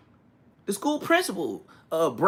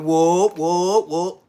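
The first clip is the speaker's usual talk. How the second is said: loud whoop whoop whoop; whoop calls; 8 dB louder, much slower than usual. Retold or imitated. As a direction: call out whoop whoop like a hype man. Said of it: "He was like".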